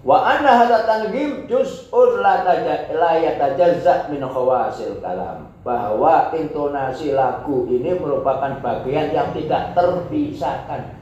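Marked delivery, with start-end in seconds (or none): 0.0-5.5 raised, louder recitation of the Arabic; Arabic text reading. Acted. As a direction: read the Arabic passage out formally. Reads Arabic text aloud.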